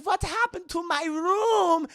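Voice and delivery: put-on high, slow, dismayed German accent. Elsa finding her room destroyed, German accent. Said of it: "You have a very German accent".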